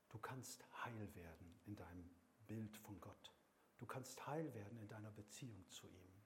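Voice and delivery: deutlich leiser, sanft zur Gemeinde sprechen; leise Predigt. Acted. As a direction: ganz nah, beinahe vertraulich predigen. (Prediger spricht der Gemeinde leise Heilung zu)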